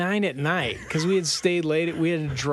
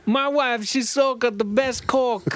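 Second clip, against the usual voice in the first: mock Italian accent; 9 semitones higher, 3 dB louder than usual. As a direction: play a proud Italian winemaker, in an Italian accent. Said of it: "with this Italian accent".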